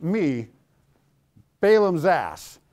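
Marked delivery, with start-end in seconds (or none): none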